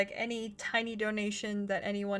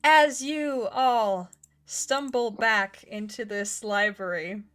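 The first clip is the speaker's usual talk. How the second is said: game master narration; 5 dB louder, much slower than usual. Acted.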